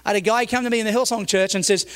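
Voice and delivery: ordinary delivery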